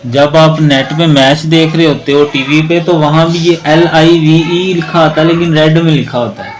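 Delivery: ordinary talk, nothing marked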